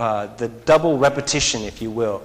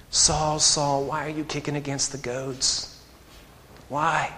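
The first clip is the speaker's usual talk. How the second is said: soft, much quieter, plaintive plea; plaintive cry; 9 dB quieter than usual. Acted. Jesus pleading with Saul, soft and plaintive. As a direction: ask it with a hurt, pleading softness. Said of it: "that plaintive cry of the Lord".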